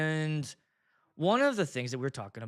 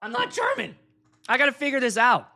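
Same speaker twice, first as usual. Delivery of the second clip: high, loud comic outburst; high-voiced comic crisis; mock panic; 8 semitones higher, 6 dB louder than usual. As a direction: exclaim it in hammed-up distress.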